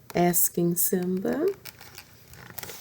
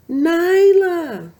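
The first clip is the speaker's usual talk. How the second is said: high, loud call across the cliffs; 12 semitones higher, 9 dB louder than usual. A narrator's reading. Mother searching for her lost cub, calling loud and high. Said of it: "her mother called again".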